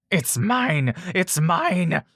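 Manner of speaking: high, frantic possessive shriek; creature screaming. A narrator's reading. Pinned swamp creature clawing for the baby, high frantic shriek. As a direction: shriek it like a cornered monster. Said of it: "she screams".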